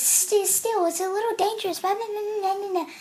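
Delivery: high, soft mocking voice; dismissive high-pitched parody trailing into blahs; mocked officer.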